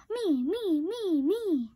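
put-on a touch of an eager kid shouting. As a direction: beg to be picked first. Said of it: "Froggy shouted".

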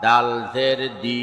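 final "দি" cut short; quick short vowel. Shown as a demonstration. Teacher demonstrating normal jer, short "দি". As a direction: keep the last "দি" brief, not held. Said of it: "তাড়াতাড়ি করতে হয়".

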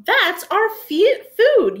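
high, loud indignant yell; high-pitched protest. Acted. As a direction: shout it as an outraged little dinosaur. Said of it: "yelled Apatosaur".

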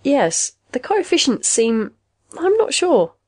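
growing feeble. A narrator's reading. Robot therapist losing confidence, feeble and unsure. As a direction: falter into high-pitched uncertainty. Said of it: "The machine's robotic voice grew feeble".

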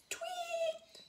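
very high, soft, held chirp; tiny bird tweet. Acted. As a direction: squeak like a little bird. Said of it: "tummy made a strange noise".

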